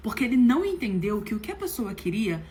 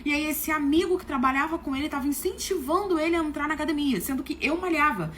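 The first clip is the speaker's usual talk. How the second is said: ordinary talk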